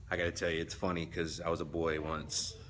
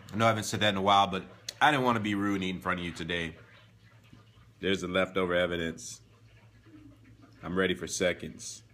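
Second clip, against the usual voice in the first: ordinary talk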